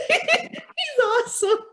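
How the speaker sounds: giggling through the words; laughing. Real, not acted. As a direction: laugh while you say it. Laughing through praise of friend.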